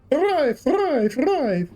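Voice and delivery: very high "thrive" with trilled R; rolled R. Pretend. Can't stop saying it, high trilled "thrive". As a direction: squeal "thrive" gleefully, rolling the R. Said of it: "Need to stop saying thrive".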